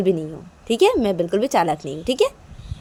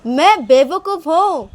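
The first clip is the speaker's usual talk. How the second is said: high, loud and drawn out; loud declaration; 8 semitones higher, 6 dB louder, slower than usual. Acted. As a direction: say it loudly and slowly so it gets heard. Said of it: "वो भी कितने ज़ोर से बोला मैंने". (ज़ोर से, खींचकर बोलना)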